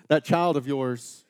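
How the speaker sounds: slow, loud, pointed blame; exasperated parent blaming the other; accusing spouse. Retold or imitated. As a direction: lean on it like blaming your spouse. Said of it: "you're like".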